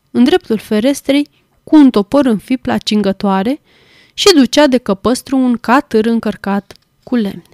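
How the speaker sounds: ordinary delivery, nothing marked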